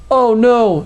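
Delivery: hammed-up scared shriek; mock scream. A narrator's reading. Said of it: "screamed the Savages member".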